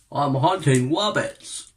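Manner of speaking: slow cartoon-hunter voice with W for R; slow, childish-R cartoon hunter imitation; Elmer Fudd. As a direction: drag it out like a lisping cartoon hunter. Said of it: "Elmer Fudd's voice".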